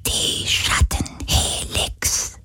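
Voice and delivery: slow breathy whisper; ghostly whisper. Acted. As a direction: breathe it like a spirit. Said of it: "flüsterte der Geist".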